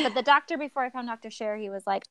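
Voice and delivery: usual speaking voice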